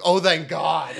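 high, laughing sigh of relief. Retold or imitated. Viewer relieved that the scene is meant funny, laughing relief. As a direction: let relief burst out through a laugh.

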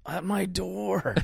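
slow, high movie-quote impression with stretched words; high character voice. Co-host quotes the actor's big movie line, high impression. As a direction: draw out the outraged line in a high voice. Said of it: "'Cause what does he say?".

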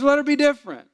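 high, loud, emphatic command; forceful order. Retold or imitated. Pastor telling the husband to back off, loud commanding rebuke.

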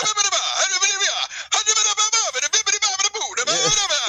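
mock Italian gibberish yelling. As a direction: rattle off fake Italian like an angry driver. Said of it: "starts yelling in Italian".

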